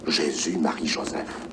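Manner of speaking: a shocked, barely voiced prayer; horrified whisper. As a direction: breathe the names out in shock. Priest aghast at empty purgatory.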